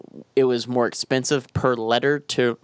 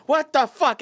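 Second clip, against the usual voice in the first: indignant what the fuck; 11 semitones higher than usual. Retold or imitated. Reacting to a British shop sign, higher mock outrage. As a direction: blurt it out in mock disbelief. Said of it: "I'm like".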